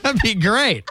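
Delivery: chuckling through words; laughing. Real, not acted. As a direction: laugh as you say it. Still laughing.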